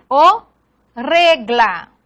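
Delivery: "O regla" in separate pieces with a pause; "O regla" said syllable by syllable; syllable division. Shown as a demonstration. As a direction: break "O regla" apart, keeping gl together. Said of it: "no se separa".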